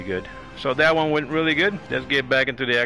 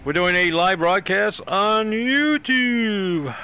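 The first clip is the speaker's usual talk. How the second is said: slow, higher, drawn-out announcer call; 7 semitones higher, 4 dB louder, much slower than usual. Acted. Host announces the live broadcast to viewers, drawn-out announcer voice. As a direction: stretch YOUTUBE out long and high.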